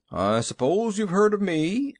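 put-on self-satisfied old gentleman; proud. A narrator's reading. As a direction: show off a little. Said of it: "with an air of pride".

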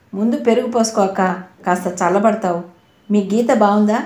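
plain reading voice

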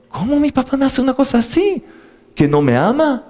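put-on high, whiny little child's voice; a child hurt and confused by his father; voiced small child. Father takes child for a shot, child protests.

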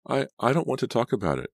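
high, flustered stammer. A narrator's reading. Laura dodging Martha's questions, stammering. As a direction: stumble on the first word, pitched high. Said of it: "she stammered".

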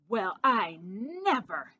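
slow, drawn-out exclaiming; indignant exclamation. A narrator's reading. Snooty aunt to mama about the field mice, exclaiming. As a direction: exclaim with stretched-out, snooty affront. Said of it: "exclaimed Aunt Snooty".

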